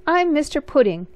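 chirpy elf. Acted. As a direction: introduce yourself brightly like a tiny elf. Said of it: "he said".

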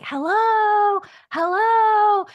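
high, loud, jolly hello from a woman; imitating a strange woman calling hello from downstairs; jolly woman's voice. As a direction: sing it out like a cheerful lady calling. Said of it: "It was this woman's voice".

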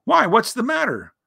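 high little bear voice; concerned Pooh. A narrator's reading. Bear asking his gloomy friend what's the matter, high and concerned.

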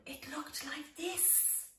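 soft unvoiced whisper, lifting at the end. Shown as a demonstration. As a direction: breathe it out with a little rising wonder. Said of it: "When he finished building".

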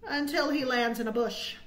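plain reading voice

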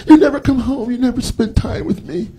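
high mock whiny girlfriend; high-pitched mock nagging girlfriend; girlfriend complaining. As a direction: whine it like a neglected girlfriend. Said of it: "girlfriend's complaint".

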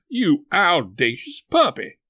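loud, slow indignant scolding. A narrator's reading. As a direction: scold him like a shocked aunt. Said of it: "she jumped up and wiped it off".

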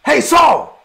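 loud high call across the cave. Retold or imitated. David calling Saul from behind.

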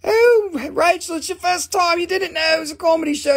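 high, put-on voice talking to Rachel; chirpy high voice teasing the newcomer; crystal voice.

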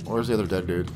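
ordinary delivery

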